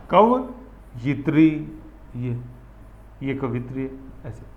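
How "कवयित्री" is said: कवयित्री very slow, each syllable spelled out; careful pronunciation. Shown as a demonstration. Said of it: "कवित्री लिखते हैं ना अपन ऐसे". (कवयित्री बहुत धीरे, अक्षर-अक्षर)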